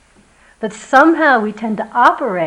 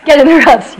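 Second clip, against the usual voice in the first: louder, higher mock yell; imitated shout; 6 semitones higher, 14 dB louder than usual. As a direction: burst out with the urgent call. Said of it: "Wes going".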